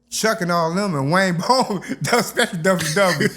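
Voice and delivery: ordinary talk, nothing marked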